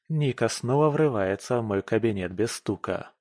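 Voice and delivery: plain reading voice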